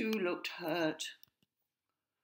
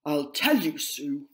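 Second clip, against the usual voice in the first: nervous; 10 dB louder than usual. A narrator's reading. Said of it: "he continued nervously".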